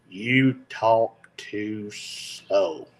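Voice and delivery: very slow drawn-out quote; quoting critics. Retold or imitated. Pastor quoting viewers who say he talks too slow, very slowly. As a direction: stretch every word out long. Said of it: "I get this one every".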